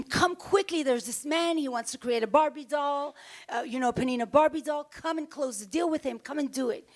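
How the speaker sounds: retelling an excited call to her husband; excited urgency. Retold or imitated. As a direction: hurry him down, bursting with news.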